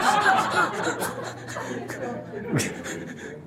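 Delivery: airy high laugh with no voice; breathy unvoiced laugh. Real, not acted. The speaker laughing at a student's complaint, breathy. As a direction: laugh silently through the nose.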